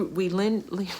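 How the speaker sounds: normal talking voice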